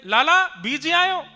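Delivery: high, loud, slow call across a distance; calling from afar. Acted. Mother spots her wet son from afar, loud slow call. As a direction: call out to the child far away. दूर ते मैया ने की.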